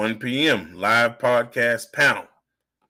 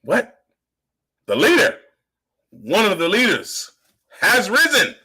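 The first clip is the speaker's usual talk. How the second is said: high, rising hype-man proclamation; triumphant proclamation; 9 semitones higher, 4 dB louder than usual. Acted. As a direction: proclaim it high and forceful, word by word. Proclaiming himself a risen leader.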